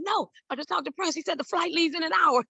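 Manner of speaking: she has to believe me, it's true; excited insisting. Retold or imitated. Telling her doubting mother it's real, high and excited.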